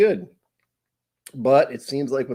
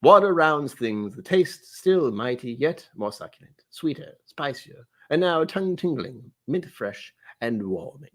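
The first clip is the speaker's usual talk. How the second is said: measured English butler reading tasting notes; English butler accent. Acted. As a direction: read it out in a put-on English accent. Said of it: "some kind of English butler".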